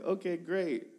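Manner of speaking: young intern answering the pastor, mock cheerful; nervous okay. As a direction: fake cheerful to hide the panic. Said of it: "I'm like".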